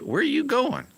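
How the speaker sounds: squeaky pretend buddy asking; mock friend voice. Friends asking where he's going, high mock voice.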